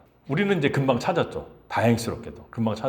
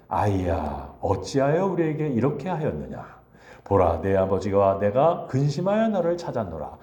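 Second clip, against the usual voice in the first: formal scripture Mary; 7 semitones lower than usual. Retold or imitated. Mary addressing young Jesus in the temple, solemn and formal. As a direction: read Mary's words like solemn scripture. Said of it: "말투가 이럴까요?".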